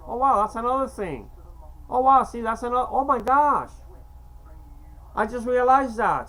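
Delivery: high, loud burst of surprise; amazed realization. Real, not acted. Speaker noticing another coincidence of dates, genuinely amazed. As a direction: exclaim it as it suddenly dawns on you.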